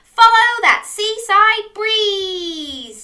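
high, loud, drawn-out call; rousing call. Acted. Crab urges Dog toward the sea, calling out. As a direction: call it out slowly like a rallying cry.

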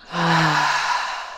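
long, breathy release through the mouth; audible sigh. Shown as a demonstration. Yoga teacher breathing along with listeners, audible sigh. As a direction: let the breath fall out in a sigh. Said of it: "sighing out".

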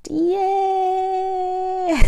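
high squealed cheer; cheerful yay. Real, not acted. Celebratory cheer.

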